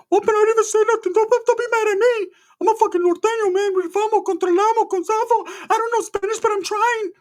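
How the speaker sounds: very high, loud squeaky cousin voice; Ricky. Retold or imitated. Cousin protesting after being told to shut up, very high loud mock voice. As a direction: put on a loud falsetto for the cousin. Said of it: "Brother, you are being kinda loud".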